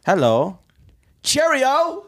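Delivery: high, hammed-up British hello; terrible British accent. Friend mocks the British stars with a terrible hammed-up British accent. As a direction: overdo a bad posh British greeting. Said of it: "That's terrible".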